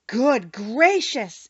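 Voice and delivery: slow, drawn-out astonished cry; exclaiming. A narrator's reading. Gatekeeper learning Dorothy melted the Witch, astonished. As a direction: let the astonishment stretch out. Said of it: "exclaimed the man".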